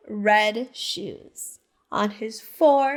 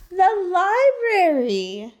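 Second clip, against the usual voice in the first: bright rising announcement, higher and louder; excited reveal; 6 semitones higher, 5 dB louder than usual. Acted. Storyteller revealing the place to children, excited. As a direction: unveil the answer with delight.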